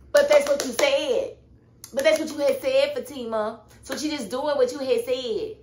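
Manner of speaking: high, loud indignant protest; loud pointed comeback; indignant retort. Retold or imitated. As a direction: throw her words back at her. Arguing back at Fatima.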